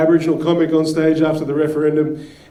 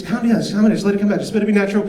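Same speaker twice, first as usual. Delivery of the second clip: fast, higher hurried calming; quick, higher urgent reassurance; friend calming; 5 semitones higher, much faster than usual. Retold or imitated. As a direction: rush to soothe. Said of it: "She goes".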